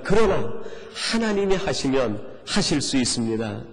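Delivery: usual speaking voice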